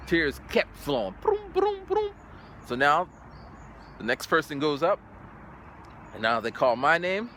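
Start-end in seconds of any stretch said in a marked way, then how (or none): 1.2-2.1 high whooshing broom broom; high cartoon flood sounds; tear flow noises. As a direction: mimic gushing tears, high. Imitating tears flowing.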